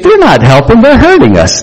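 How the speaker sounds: high, outraged protest; indignant fan. Retold or imitated. Fan in the stands protesting the cheerleaders, high and indignant. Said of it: "You would think".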